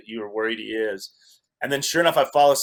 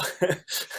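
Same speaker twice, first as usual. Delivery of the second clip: short breathy laugh; a quick, airless laugh at himself; 6 semitones higher, 5 dB quieter than usual. Real, not acted. Laughing at his own story.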